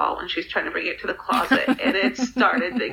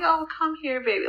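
higher, melting coo; tender to a child; 8 semitones higher than usual. Retold or imitated. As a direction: want to scoop the baby up. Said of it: "you're just like".

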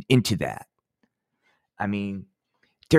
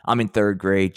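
ordinary delivery

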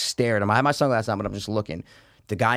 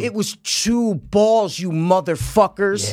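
higher, slowly hammered-out telling-off; indignant tone; 6 semitones higher, 4 dB louder, slower than usual. Retold or imitated. Golfer scolding players who nearly hit him, indignant. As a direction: spell out the offense, word by word. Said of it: "this is the tone I'm saying".

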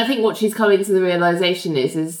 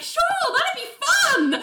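squeaky, much higher sugary reply; mock sweet Ling. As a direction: sing it up high in fake delight. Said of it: "Ling's like".

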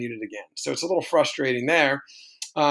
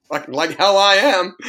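emphatic refusal; 4 semitones higher, 7 dB louder than usual. Retold or imitated. Retorting to opponent's request.

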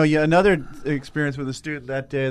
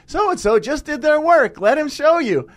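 much higher, slow, pleased; proud teacher voice; 12 semitones higher, 3 dB louder than usual. Retold or imitated. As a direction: show him off proudly, slowly. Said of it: "So I was able to say".

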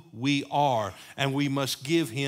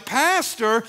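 normal talking voice

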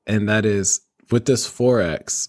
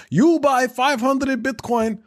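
mock Russian accent; 11 semitones higher than usual. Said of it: "So he's like".

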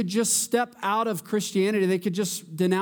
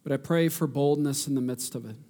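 earnest pleading prayer; 3 semitones lower than usual. Real, not acted.

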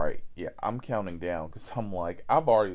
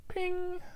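ping sound; 3 dB quieter than usual. Retold or imitated. Imitating app notification.

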